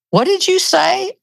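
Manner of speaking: high-pitched, startled question; retold disbelief. Husband questioning his wife in the truck, retold with high disbelief. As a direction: imitate a taken-aback husband, voice jumping up.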